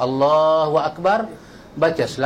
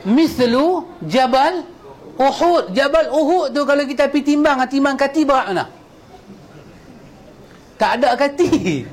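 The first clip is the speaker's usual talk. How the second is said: awed emphasis; 8 semitones higher than usual. Acted. Awed build about Mount Uhud.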